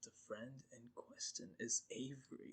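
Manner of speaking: hushed mock-serious whisper. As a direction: whisper the intro softly. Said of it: "Why are we whispering?".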